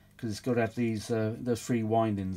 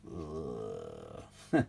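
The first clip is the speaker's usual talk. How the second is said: weary groan; 11 semitones lower, 6 dB quieter than usual. Real, not acted. Weary groan while tired.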